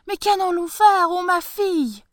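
high, loud despairing plea; old farmer despairing to his daughter. Acted. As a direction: wail in despair.

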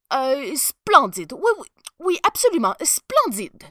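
high, loud flustered praise; desperate stammering. A narrator's reading. Emperor covering up that he sees nothing, stammering desperately. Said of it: "bredouilla l'empereur, désespéré".

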